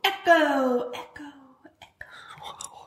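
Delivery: fading echo, dying to a whisper; digital echo. Pretend. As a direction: echo fading away into nothing. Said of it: "record in a tin can".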